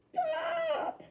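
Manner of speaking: high, soft crying stop; begging him to stop through tears; sobbing little stop; tearful plea. A narrator's reading. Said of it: "said Christopher".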